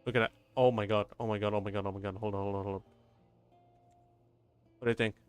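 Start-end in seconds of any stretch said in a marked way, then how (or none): none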